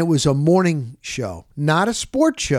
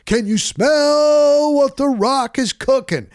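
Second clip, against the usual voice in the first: high, slow booming catchphrase; hammed-up Rock impression; 9 semitones higher, 4 dB louder, slower than usual. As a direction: belt it out like the wrestler. Quoting The Rock's catchphrase.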